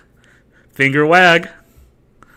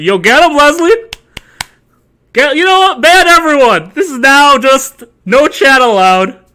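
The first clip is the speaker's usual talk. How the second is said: much higher, louder rally cry; hyped shout; 9 semitones higher, 5 dB louder than usual. Pretend. Streamer cheering Leslie to ban everyone, hyped shout. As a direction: cheer the mod on. Hyping mod to ban chat.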